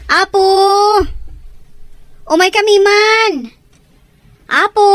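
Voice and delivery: loud, high call to the house. Acted. Visitors at the gate calling loudly to whoever is home. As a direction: hail the people inside from outside.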